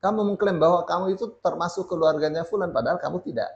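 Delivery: usual speaking voice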